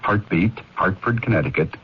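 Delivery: ordinary delivery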